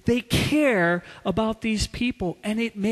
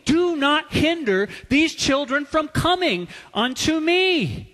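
loud, higher, hammering each word; furious; 8 semitones higher, 5 dB louder than usual. Retold or imitated. Jesus rebuking disciples for blocking children, furious. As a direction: rebuke them with fury. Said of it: "He was furious at them".

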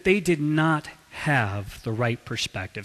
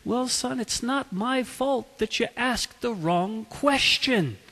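softer, higher, slow smiling delivery; mild, kindly priest letting him down; smiling reply; 6 semitones higher, 3 dB quieter, slower than usual. Acted. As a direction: answer gently through a smile, drawing it out. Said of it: "looked at him and smiled and he said".

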